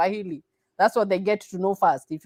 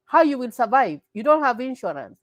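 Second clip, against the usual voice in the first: ordinary talk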